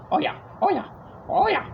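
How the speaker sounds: squeaky excited video game sidekick; Luigi voice. Retold or imitated. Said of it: "like, Luigi".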